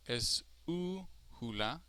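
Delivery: esújula with a long, drawn-out stressed u; stress on third-to-last. Shown as a demonstration. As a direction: stretch the u in esújula, slowly. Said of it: "strongest sounding syllable for an esdrújula".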